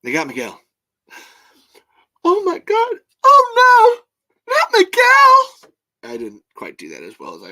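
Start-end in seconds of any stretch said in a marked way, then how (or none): 2.2-5.7 high, loud anguished wail; Tremors movie impression. Host performs a favorite Tremors line for viewers, anguished high shout. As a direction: wail it out at full volume, drawn-out and high. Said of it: "do 'em exactly in the voice".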